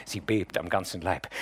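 usual speaking voice

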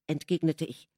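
plain reading voice

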